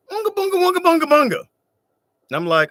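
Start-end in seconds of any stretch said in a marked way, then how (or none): 0.1-1.4 high, loud grunting caveman gibberish; hammed-up primitive tribe voice; mock cavewoman. Friend Desiree pretends to speak caveman, hammed-up grunting gibberish. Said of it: "she looks at me and she's like".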